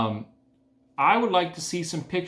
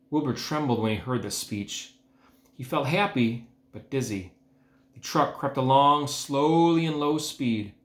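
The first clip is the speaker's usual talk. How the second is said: plain reading voice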